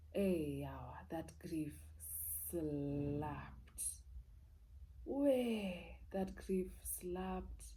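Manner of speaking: mild hushed, heavy sigh of words; heavy grief. Real, not acted. Recalling her father's death, hushed and heavy. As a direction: let each word sink slowly.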